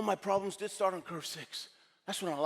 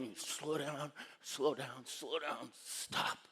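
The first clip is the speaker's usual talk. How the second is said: soft, easing down to a whispered stop; slowing to a stop; 2 semitones lower, 9 dB quieter, much slower than usual. Acted. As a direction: let it die away softly until the last word is only breath. Acts the sled slowing to stop.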